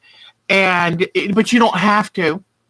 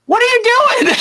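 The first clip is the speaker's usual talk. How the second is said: mock husband, much higher and puzzled; husband's puzzled question; faster than usual. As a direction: squeak out the husband's baffled question like a cartoon. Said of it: "Mark said".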